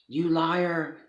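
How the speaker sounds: high, loud, spat-out accusation; furious accusation. Retold or imitated. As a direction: hurl it at the child like a slap. Grandfather accusing before a slap.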